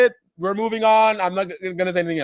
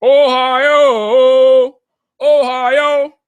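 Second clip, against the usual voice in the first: loud, higher, held-out place-name call; hammed-up call; 4 semitones higher, 6 dB louder, much slower than usual. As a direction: belt out Ohio like a chant. Chants the state name theatrically.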